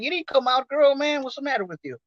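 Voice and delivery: high, incredulous retort; mocking disbelief.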